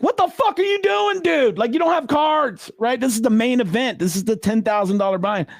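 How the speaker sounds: screaming. Retold or imitated. Dealer's held-in scream at the player going all in, high and incredulous. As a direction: yell in disbelief at the reckless player. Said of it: "I wanted to just scream".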